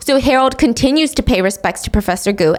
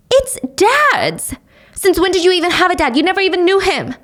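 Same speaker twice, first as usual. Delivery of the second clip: much higher, fast and cutting; bitter mother; 8 semitones higher than usual. Acted. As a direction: throw it back at her, furious. Mother quarrels with Sonny.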